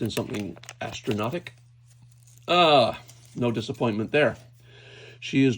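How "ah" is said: usual speaking voice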